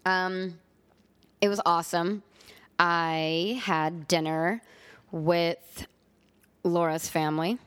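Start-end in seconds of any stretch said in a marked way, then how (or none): none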